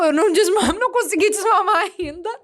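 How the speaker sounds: loud, laughing admission. Real, not acted. Mother laughing as she admits she still hasn't weaned him. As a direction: laugh through the confession.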